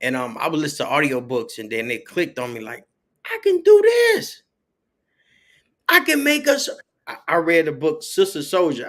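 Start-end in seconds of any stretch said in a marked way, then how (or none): 3.2-6.7 excited realization. Retold or imitated. Author recalling his idea, shouting it out with high excitement. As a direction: exclaim it like a lightbulb moment. Sudden realization, acted inner voice.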